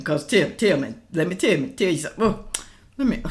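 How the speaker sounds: stumbling over the words, restarting; low, flustered false starts; tongue-tied. Real, not acted. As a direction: trip over your own phrases and give up. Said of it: "I'm getting tongue-tied here".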